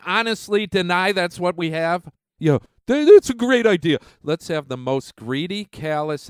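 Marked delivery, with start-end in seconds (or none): 2.4-4.0 sarcastic. Real, not acted. Sarcastic agreement.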